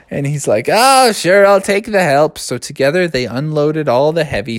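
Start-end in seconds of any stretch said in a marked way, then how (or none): 0.7-2.4 put-on old owl.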